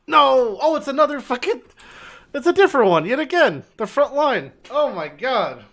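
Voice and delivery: loud high yelling in dismay; frustrated yell. Real, not acted. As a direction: yell out at the bad luck. Yells at a bad map.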